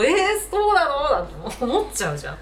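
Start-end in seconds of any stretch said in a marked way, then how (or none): 0.0-1.0 high, loud burst of astonishment; surprised reaction. Acted. Listener reacting to a commentator's touching runner story, surprised. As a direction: re-enact a wide-eyed listener's amazement.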